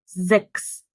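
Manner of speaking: "زكس" with a buzzing z at the start; voiced Z onset. Shown as a demonstration. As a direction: open "زكس" on a voiced z, not s. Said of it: "مش سكس". ("زكس" بزاي مجهورة في الأول)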